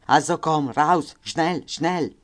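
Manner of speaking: loud, high, hurried commands in German; guard shouting at a prisoner in German; shouted German order. Retold or imitated. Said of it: "Lui rispose allora nella confusione".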